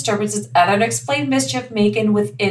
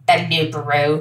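put-on low British storyteller accent; name the beast in a British accent; 5 semitones lower than usual. Podcaster naming a legendary werewolf, mock British accent. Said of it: "I don't know why I wanna do a British accent".

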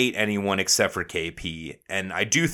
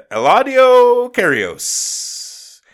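announcer call; 7 dB louder, much slower than usual. Pretend. Ring announcer name call.

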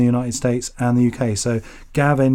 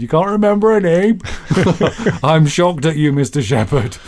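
higher, mock-shocked quoted voice; 4 semitones higher than usual. Host quoting a poet who teased him, mock shock. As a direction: imitate an incredulous ribbing. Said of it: "that's what [name] said".

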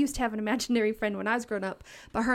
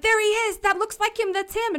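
put-on high, louder kid's cry; excited child shout; 10 semitones higher, 4 dB louder than usual. Boy spotting Johnny Peach at Walmart, high excited call. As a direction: point and shout like a thrilled little boy. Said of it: "starts screaming".

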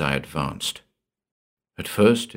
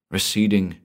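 ordinary delivery

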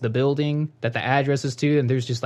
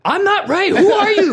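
high, loud, indignant imitation; mock angry yell; 6 dB louder than usual. Stranger behind the glass challenging the caller, mock angry yell. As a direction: yell it back in comic outrage. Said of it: "he's yelling louder".

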